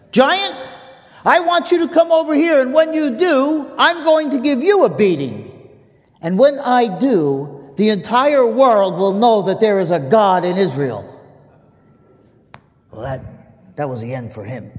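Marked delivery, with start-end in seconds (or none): 0.1-11.0 loud, high proclamation, slowing at the end; boy shouting his faith at a charging giant; defiant shout. Acted. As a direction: declare it at full voice to the giant. Boy's defiant battle cry.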